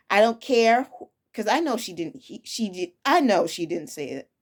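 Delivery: ordinary delivery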